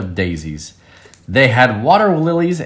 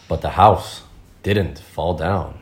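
ordinary delivery

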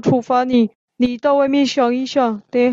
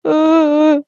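louder, choking sobs; louder sobbing; 2 semitones higher, 4 dB louder than usual. Acted. Xixi crying louder in front of the teacher, heaving sobs. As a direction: sob harder and louder. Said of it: "西西这时候哭得更大声了".

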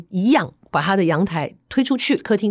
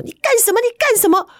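high, shrill-pitched mimic of a frightened wife; imitating the wife crying out in alarm; wife's alarmed cry; faster than usual. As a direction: squeal it out like the startled wife. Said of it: "就听到那个太太说".

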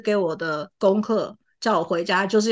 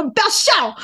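teacher scolding; 10 semitones higher, 3 dB louder than usual. Retold or imitated. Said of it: "他说".

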